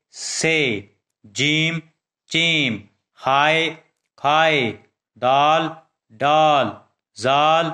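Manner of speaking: each letter called out alone with a steady pause between; alphabet recitation. Acted. Teacher reciting the alphabet to young pupils, measured and firm. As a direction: chant the letters one by one, firm and even, for children to repeat.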